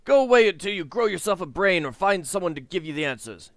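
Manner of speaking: high, loud scornful dismissal. Acted. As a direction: spit the words with contempt. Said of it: "He spits scornfully".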